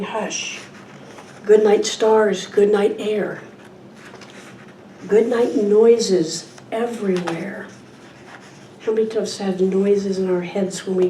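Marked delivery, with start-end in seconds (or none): 0.0-0.6 very soft, hushed single word; whispered hush. A narrator's reading. Good night to the old lady, whispering. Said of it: "old lady whispering". 1.4-7.7 slow, spaced-out good-nights; bedtime story reading. Acted. Speaker ends a children's book, slow bedtime reading.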